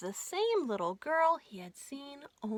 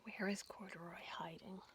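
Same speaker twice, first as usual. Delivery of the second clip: whispered aside; 4 semitones lower, 13 dB quieter than usual. Shown as a demonstration. Reader aside to child listener.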